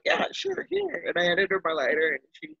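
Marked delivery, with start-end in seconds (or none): 0.0-1.0 awkward. Retold or imitated. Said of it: "And I was like".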